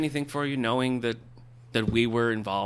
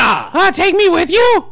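pleading shout; 13 dB louder, slower than usual. Acted.

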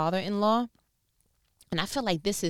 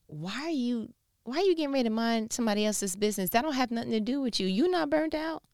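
inner voice, quick; 7 semitones higher, faster than usual. Pretend. Inner brain voice quoted.

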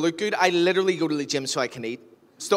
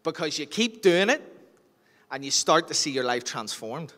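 usual speaking voice

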